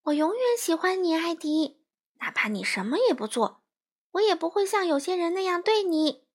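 comforting a friend while pointedly aiming it at mother. A narrator's reading. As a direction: say it high so mother hears. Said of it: "把声音提得老高".

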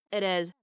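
"it is" run together with a flapped t; American flap T joining "it is" into "ih-diz"; linked "it is". Shown as a demonstration. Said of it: "连读可以读成".

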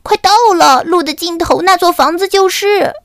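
high, loud excited pointing; nearly there, bubbling over; excited announcement. Acted. Berio points ahead to the mill, high and excited. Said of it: "贝里奥指着前方兴奋地说".

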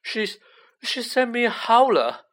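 put-on high, horrified boy's voice; falter, then blurt it out high; faint dread. A narrator's reading. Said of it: "said Ron faintly".

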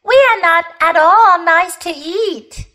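high, emphatic denial; hasty protest. A narrator's reading. Polly warning animals off eating them, hasty protest. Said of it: "said Polly hastily".

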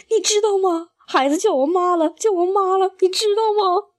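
high, weepy stepmother's plea; tearful plea. A narrator's reading. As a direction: cry it out high, overwhelmed with joy. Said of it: "哭着扑到爸的怀里".

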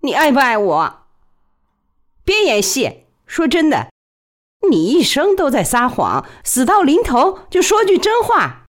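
high, pressing woman's voice; intense woman's voice. A narrator's reading. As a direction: demand the truth, rising. Woman interrogating lover at knifepoint.

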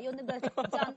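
ordinary talk, nothing marked

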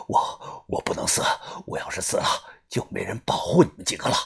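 gasping for breath. A narrator's reading. Wounded brother Yu grips her hand, gasping. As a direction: push it out between gasps. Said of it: "喘着气说道".

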